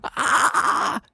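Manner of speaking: high, loud scream; sudden hammed-up shriek; acted yell. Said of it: "started to shake and yelled".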